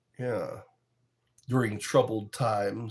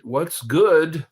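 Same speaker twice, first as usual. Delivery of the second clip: put-on voice; 3 semitones higher, 5 dB louder, slower than usual. Reads viewer phrase in voice.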